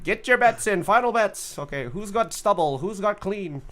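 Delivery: a playful, lightly voiced bookie calling last bets; mock bookie. Host to chat, pretending to take stubble bets, in a mock bookie call. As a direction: hype up the crowd to bet, half joking.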